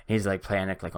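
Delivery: ordinary talk, nothing marked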